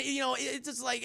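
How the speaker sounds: ordinary delivery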